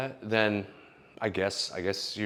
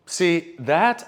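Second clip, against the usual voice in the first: normal talking voice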